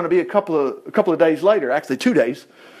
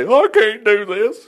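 slow, high mock whine; hammed-up helpless whine; whining Moses; 4 semitones higher, slower than usual. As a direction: drag it out like a reluctant complainer. Voices Moses still making excuses.

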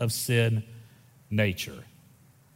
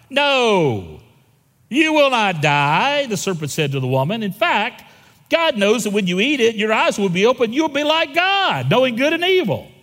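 put-on Satan West Texas accent; 7 semitones higher, 4 dB louder than usual. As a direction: play the serpent with a hammed-up West Texas accent. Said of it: "Did y'all know Satan had a West Texas accent?".

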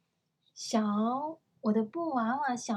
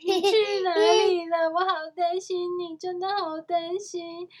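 high, loud wailing that settles down; sobbing reunion cry, easing off; 7 semitones higher, 7 dB louder than usual. Acted. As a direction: wail it like a girl hugging her lost doll. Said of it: "然后放声大哭".

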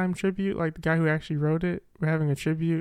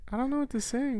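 high voice; 9 semitones higher, 5 dB quieter than usual. Real, not acted. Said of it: "this boy sings high".

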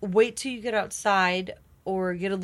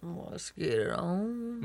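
slow, soft, seductive fry; slow seductive come-on; sexy voice; 5 dB quieter, much slower than usual. Pretend.

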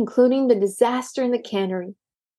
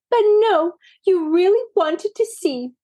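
reproachful; 8 semitones higher than usual. A narrator's reading. Maisie reproaching Daisy.

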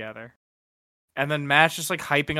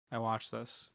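quiet repeat; 2 semitones lower, 13 dB quieter than usual. Real, not acted.

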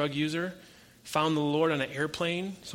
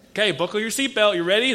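louder, higher, teasing buildup; hyped-up setup; 6 semitones higher, 8 dB louder than usual. Retold or imitated. Preacher hyping a friend before his answer, excited and teasing. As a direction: build the suspense like a showman.

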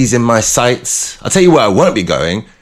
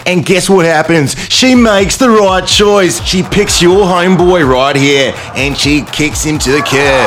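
hyped-up sports announcer, a bit higher and louder; 6 semitones higher, 3 dB louder than usual. Acted. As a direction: call it like a live match finale. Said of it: "Like a competitive sport right now".

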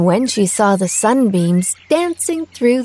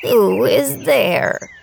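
slow, frail grandmother call; lightly voiced old granny; 2 semitones higher, much slower than usual. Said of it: "cried grandmother".

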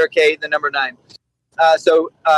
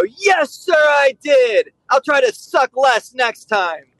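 high, crisp yes sir, then slower; officer owning his mistake to the commander; eager soldier reply; 7 semitones higher, slower than usual. Retold or imitated. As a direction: play the cheerful owning-it subordinate. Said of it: "And I'd go".

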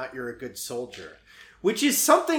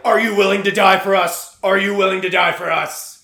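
mock military demand; 3 semitones higher, 9 dB louder than usual.

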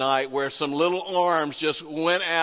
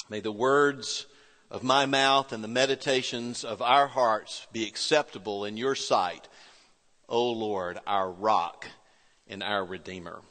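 soft, low prayer voice; hushed opening prayer; 6 semitones lower, 3 dB quieter than usual. Acted. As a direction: pray it quietly and reverently. Opening prayer before sermon.

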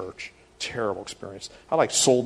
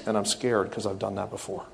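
usual speaking voice

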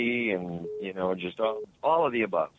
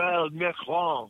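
Emmanuel Macron in a light French accent; 5 semitones higher than usual. Shown as a demonstration. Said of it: "I can't say it the way you do".

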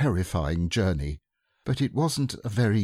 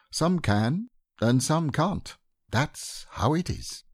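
higher-pitched character voice, slowing at the end; lightly voiced higher musing thought; musing bear; 8 semitones higher, slower than usual. A narrator's reading. Pooh thinking after watching Kanga go, higher musing voice.

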